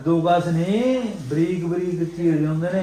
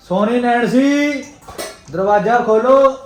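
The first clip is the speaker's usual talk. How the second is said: calling out; 7 semitones higher, 5 dB louder than usual. Real, not acted. Calling someone to the door.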